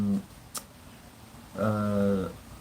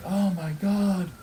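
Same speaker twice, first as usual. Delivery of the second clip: slow, higher gasping oh my god; shocked onlookers; 8 semitones higher, slower than usual. Retold or imitated. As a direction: drag it out in horror. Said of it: "everyone else is like".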